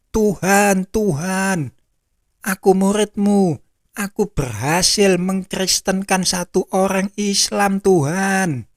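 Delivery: high, pleading call without full shouting; acted crying out. Disciple boasts of a convert to the Lord, high acted cry. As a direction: plead your case to the Lord. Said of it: "saya akan teriak-teriak".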